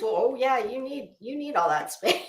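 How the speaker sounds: usual speaking voice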